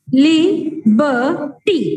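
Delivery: Liberty split into slow drawn-out syllables; teacher counting syllables on fingers. Shown as a demonstration. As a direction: spell out the beats of Liberty slowly. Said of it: "count on your fingers".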